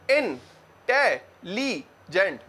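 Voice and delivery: "इंटेलिजेंट" stretched very slowly into four beats; split into syllables. Shown as a demonstration. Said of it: "चार टुकड़े, चार syllable हैं।".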